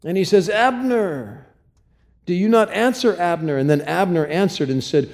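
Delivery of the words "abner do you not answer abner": high call across the hill; mock hilltop call. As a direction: call out to the sleeping guard. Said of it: "he's calling out to Abner".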